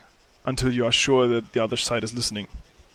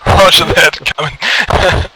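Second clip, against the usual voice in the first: radio call; 5 semitones higher, 12 dB louder, slower than usual. Acted. Leader on radio, laughing.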